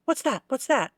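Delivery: high, anxious-sounding repeated question; insistent questioning. Retold or imitated. Podcaster imitating the film's girlfriend who keeps asking, high and insistent. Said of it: "she just kept going on and on".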